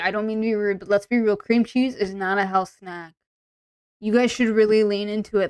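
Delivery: ordinary talk, nothing marked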